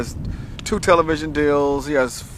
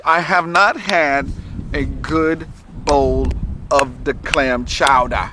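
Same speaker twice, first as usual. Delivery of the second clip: drawn-out craving; much slower than usual. Real, not acted. Craving chowder, dragged out.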